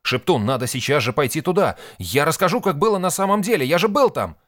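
high, loud, building plea; urgent protest. A narrator's reading. Nik urging Sheptun to let him testify, frantic.

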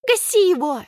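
high fierce cheering shout; shrieking. Acted. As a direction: urge him to finish it. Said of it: "верещит Алька".

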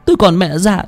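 high, tearful begging; a frightened girl begging the old man to spare her; imploring. Acted. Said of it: "Cô gái vẫn cứ khẩn khoản".

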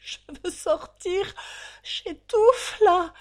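a trapped prisoner crying out to be let out; desperate cry. Acted. Drunk prisoner in the van begs to get out, panicked wail. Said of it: "pleurait lui aussi sa maisonnée".

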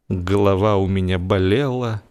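ordinary delivery

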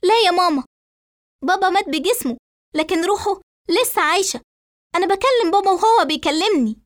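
high-pitched, insistent protest; daughter's nervy insistence, pitched high. A narrator's reading. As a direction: argue back heatedly in a high voice. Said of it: "فترد ريتال بعصبية وصوت مرتفع".

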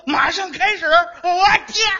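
loud, high build-up to a sneeze; sneezing fit. Acted. Tutor catches cold on hearing phonetics, sneezing fit. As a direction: let a fake sneeze take over the words. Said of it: "你这感冒也太快了".

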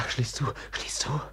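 whispered, hurried; urgent whisper. Acted. Brother urges Pawin to lock the door in a whisper. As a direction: whisper it so nobody hears.

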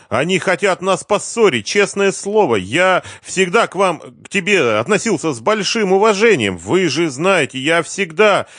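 high, loud, pleading voice; desperate pleading. A narrator's reading. Cornered man pleads with his accuser.

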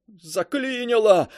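high, slow, tearful shout; wailing yell. A narrator's reading. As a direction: wail it in despair. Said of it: "плачуще проорал Каблуков".

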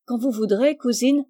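ordinary delivery

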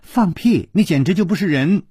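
high-pitched indignant cursing; foot-stamping princess fury; angry outburst. A narrator's reading. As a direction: spit it out in a tantrum. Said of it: "她跺着脚说".

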